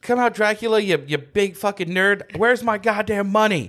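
hammed-up comic-book hero calling out a villain; Moon Knight. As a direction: pitch it up and demand it, playfully. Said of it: "what's it that the Moon Knight be like".

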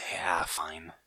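soft, sighed giving in; grudging concession. Real, not acted. Co-host conceding a teasing argument, quiet and grudging. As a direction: drop low and quiet after a sigh. Gives in after losing the bickering.

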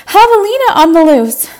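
high, loud frantic yell; panicked customers shrieking; screaming alarm. Acted. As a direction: shout the warning as you flee the shop. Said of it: "screaming things like".

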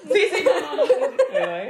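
high, flustered giggling protest; flustered laughing. Real, not acted. Flustered laughing as friend teases back about her bum. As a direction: laugh it off, caught out.

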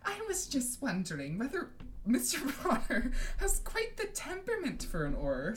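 high, affected sweetness; falsely sweet, simpering busybody; sweetly. A narrator's reading. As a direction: put on a sugary high voice. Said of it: "said Professor Umbridge sweetly".

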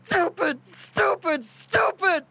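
high, rising furious repeats; angry self-scolding. Acted. Dean angry at himself for failing, furious self-scolding.

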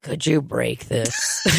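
imitating Donald. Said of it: "And then he goes".

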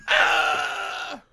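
loud, drawn-out comic yell; mock scream. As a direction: let out a pretend scream. Mimes the clown-popping moment.

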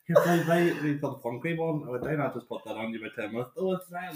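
light, high mock fussing; acted-out fussy friend. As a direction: play out a mock exchange between mates. Acting out friends applying sun cream.

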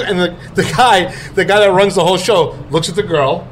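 normal talking voice

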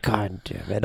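ordinary delivery

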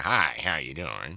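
put-on unbothered American accent. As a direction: imitate an actor drawling in plain American. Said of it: "who doesn't even bother at all".

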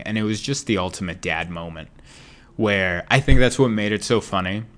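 usual speaking voice

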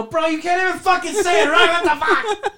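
high exasperated yell, laughing at the end; mock exasperated yell. Dad yelling at kid he can't say Spanish, loud mock exasperation. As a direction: throw up your hands and yell at him. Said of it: "And I go".

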